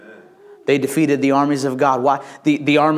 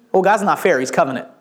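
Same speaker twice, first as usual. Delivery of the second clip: usual speaking voice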